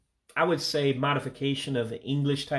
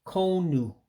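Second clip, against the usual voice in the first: Igbo accent; 6 semitones higher than usual. Shown as a demonstration. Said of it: "like saying cone with an accent".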